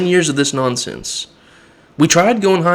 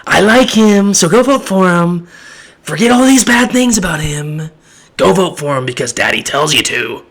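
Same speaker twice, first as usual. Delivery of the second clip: higher, louder mocking boss voice; mock Daddy voice; 4 semitones higher, 4 dB louder than usual. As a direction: lampoon a bossy leader giving orders. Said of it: "Daddy comes out and says".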